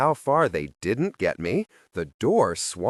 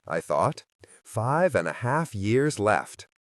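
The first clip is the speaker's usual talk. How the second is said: plain reading voice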